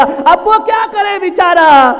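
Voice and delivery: high, loud outcry; impassioned question. Real, not acted. Preacher asking what the poor child can do, shouted. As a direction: throw the question out forcefully.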